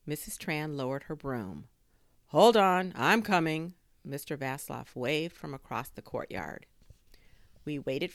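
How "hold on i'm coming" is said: loud, raised call. A narrator's reading. Inventor hurrying over to neighbours, loud calling out. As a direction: call across a courtyard. Inventor calls across the courtyard.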